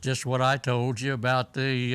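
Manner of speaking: usual speaking voice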